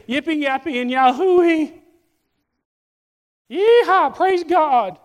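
high, loud hammed-up whooping; mock jubilant cheering. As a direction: whoop it up like an overexcited churchgoer. Mimicking Sunday churchgoers' gleeful worship.

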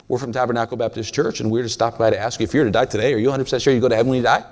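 rattled-off door-to-door church pitch; rehearsed script. Retold or imitated. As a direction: race through the memorized spiel without a breath. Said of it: "I had the script".

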